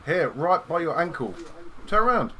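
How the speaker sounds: loud, high exasperated call; frustrated shout. Real, not acted. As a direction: yell at your friend to turn round.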